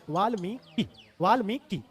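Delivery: वाल्मीकि drawn out as "vaal-mee-ki"; syllable-by-syllable pronunciation. Shown as a demonstration. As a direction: separate each syllable of वाल्मीकि. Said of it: "ऐसे बोला जाता है".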